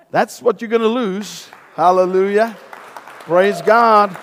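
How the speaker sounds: normal talking voice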